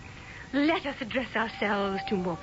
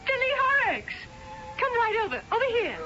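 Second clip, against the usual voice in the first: calling out; 10 semitones higher than usual. Real, not acted. Calling Billy across the room.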